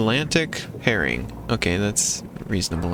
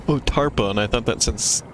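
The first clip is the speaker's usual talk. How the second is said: ordinary talk, nothing marked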